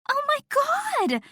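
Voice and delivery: very high, drawn-out squeal. A narrator's reading. Fangirl friend hears the big news, squealing. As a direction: squeal it out in delight. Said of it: "Nat squealed and hugged me so hard".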